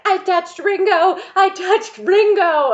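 high, loud, squealed mock fan hysteria; hysterical fangirl. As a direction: shriek it like a starstruck girl, hammed-up. Girl fan runs to friends, hysterical.

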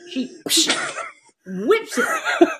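very high, loud squeal through laughter; laughing hard. Real, not acted. Host joins in the hilarity.

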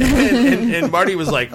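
ordinary delivery

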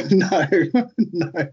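real laugh breaking the denial. Real, not acted. Asked about expenses, laughs.

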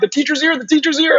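high, thrilled repeat of the teacher's here; giddy awe, voice climbing high; excited inner voice. Retold or imitated. Retelling his silent thrill at the puja, high and excited. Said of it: "I'm like, in my mind".

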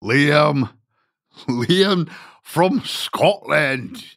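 high-pitched, drawn-out mock Scottish accent; badly faked Scottish lilt, pitched high. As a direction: do a hammed-up, terrible Scottish accent, slowly. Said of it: "That is the worst Scottish accent in the world".

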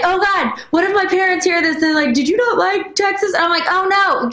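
put-on high, panicky inner child voice; little girl panicking about her parents, voice up high; panicked little girl. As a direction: squeak it out like a scared little girl. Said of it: "your little girl right now is having a little panic attack".

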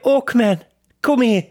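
loud, very high British call; Spider-Man. Acted. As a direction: holler it like a cartoon Brit. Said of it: "And then Spider-Man swings".

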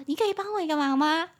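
high-pitched, put-on politeness; mock-sweet request. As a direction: ask a teasing friend with fake sweetness. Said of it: "但其实是开玩笑". (假装甜甜地拜托)

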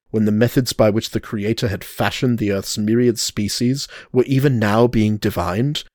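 ordinary delivery

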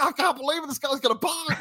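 a salesman who can't believe his luck; thrilled salesman. Retold or imitated. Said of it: "you could hear the excitement in his voice".

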